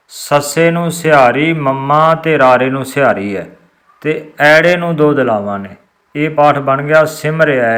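usual speaking voice

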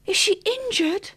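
high, alarmed question; horrified. A narrator's reading. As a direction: ask it in horror, pitched high. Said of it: "Goldie asked in horror".

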